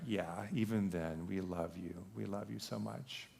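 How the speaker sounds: very soft, low retold fatherly voice; tender reassurance. Father telling son he is still loved, tender and soft. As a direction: reassure the child gently, almost hushed.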